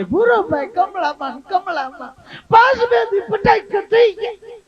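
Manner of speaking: put-on high-pitched, slow, shouted old woman's voice; hammed-up village gossip voice. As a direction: wail out the dead names like a nosy aunty. Visitor listing jaundice deaths.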